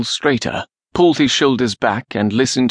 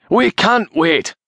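high, drawn-out protest; urgent retort; 9 semitones higher, slower than usual. A narrator's reading. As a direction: insist with raised pitch, each word stretched. Acted retort in battle argument.

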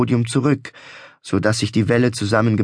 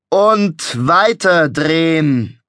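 raised, theatrical carnival barker; showman voice; 5 semitones higher, 4 dB louder, much slower than usual. A narrator's reading. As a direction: proclaim it like a fairground showman. Showman repeating for effect.

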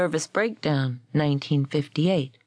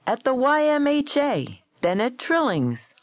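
higher, emphatic arguing; insistent protest; 6 semitones higher than usual. A narrator's reading. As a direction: insist on it, pitch jumping up. Gold insisting he heard Frost.

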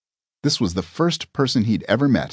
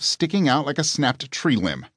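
ordinary delivery, nothing marked

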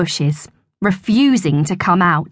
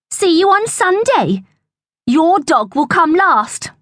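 high, gloating farewell; taunting; 10 semitones higher than usual. A narrator's reading. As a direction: jeer as you walk off. Bully's parting taunt.

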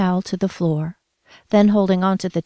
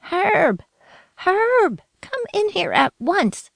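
frantic yell; 12 semitones higher, slower than usual. A narrator's reading. Grandmother yelling for her husband, high frantic call. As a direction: shout for help down the hall. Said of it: "she yelled to her husband".

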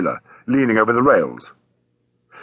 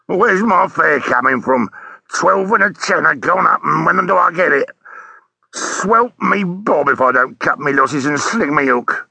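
disgruntled taxi driver; 4 semitones higher, slower than usual. Acted. Taxi driver telling a policeman about his missing fare, disgruntled. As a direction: complain like a put-out London cabbie.

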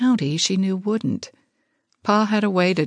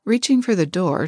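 usual speaking voice

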